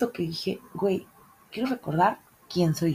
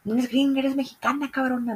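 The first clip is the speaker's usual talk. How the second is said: imitating a scolding friend, higher; mock scolding, pitched up; 7 semitones higher than usual. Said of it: "me regañaba mucho y me decía".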